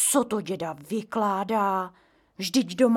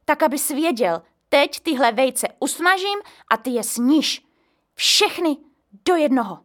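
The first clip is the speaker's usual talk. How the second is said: angry; 5 semitones higher, 7 dB louder than usual. A narrator's reading. Mother scolds grandpa.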